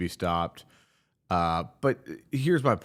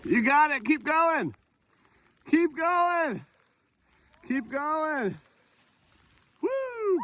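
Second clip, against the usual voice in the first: cheering on; slower than usual. Real, not acted. Cheering kid sledding downhill.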